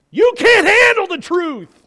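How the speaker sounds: high, loud shouted movie line; movie shout quote. Acted. Preacher quoting a film, high loud shout. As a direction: bark it like the famous courtroom scene.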